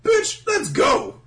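high, loud, drawn-out holler; mock exasperated yell; impatient shout. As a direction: bellow the order slowly, hammed up. Co-host joins in as impatient Luffy.

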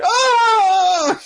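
high, loud hammed-up shriek; mock scream. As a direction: let out a cartoon yelp. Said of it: "He's like".

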